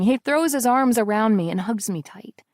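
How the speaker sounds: ordinary delivery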